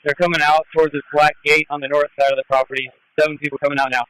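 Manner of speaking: police radio with crackling bursts; like a squad car radio call; radio static bursts. Real, not acted.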